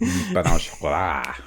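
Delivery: slow, careful Pain au chocolat in French; French accent. Shown as a demonstration. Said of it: "My French accent".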